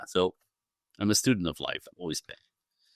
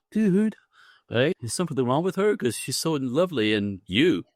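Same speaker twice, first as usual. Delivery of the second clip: high, disbelieving buddy voice; mock his friends' disbelief; imitating friends asking what's wrong with her; incredulous friend; 6 semitones higher than usual. Friends quoted reacting to his wife.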